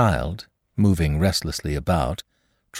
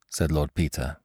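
ordinary talk, nothing marked

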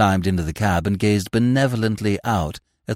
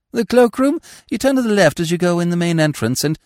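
higher, obliging directions, speeding up; lightly airy, unruffled helpfulness; blandly helpful; 7 semitones higher, 3 dB louder than usual. Acted. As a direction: misunderstand innocently and give directions. Smith deliberately misunderstands, unruffled.

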